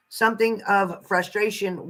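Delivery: normal talking voice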